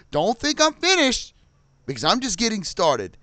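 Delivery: high, loud threatening shout; angry shout. Real, not acted. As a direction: yell it as a warning to the airline.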